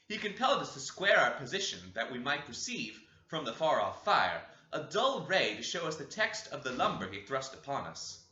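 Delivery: plain reading voice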